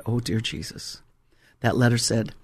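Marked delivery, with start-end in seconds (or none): 0.0-1.0 low, soft sinking reaction; hushed dismay. Retold or imitated. Pastor reads a foreclosure letter, hushed dismay. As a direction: drop to a quiet, inward prayer.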